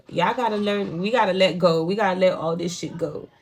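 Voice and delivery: ordinary talk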